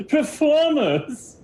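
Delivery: high, drawn-out mocking exclamation; scornful showman wizard. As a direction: repeat it as if it were laughable. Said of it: "a display of laughter".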